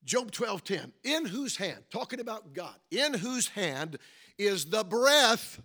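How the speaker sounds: loud, emphatic scripture reading, drawn out at the end; forceful preaching. Real, not acted. Preacher quoting Job to the congregation, forceful. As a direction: preach it loud, building to a big last word.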